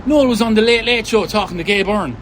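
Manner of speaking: retold motherly announcement, loud and high; imitated mum. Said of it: "she said to me".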